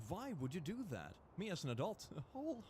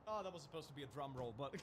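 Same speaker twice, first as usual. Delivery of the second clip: ordinary talk, nothing marked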